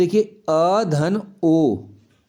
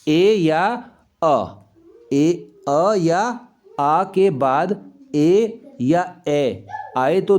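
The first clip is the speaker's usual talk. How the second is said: slow dictation; much slower than usual. Shown as a demonstration. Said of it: "dictating rule slowly".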